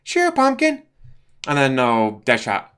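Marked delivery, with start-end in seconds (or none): 0.0-0.8 high, loud girly put-on voice; Harley voice. Harley answering Joker, high cooing put-on voice. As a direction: coo it sweetly to your man.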